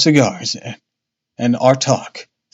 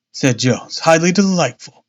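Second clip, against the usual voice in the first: plain reading voice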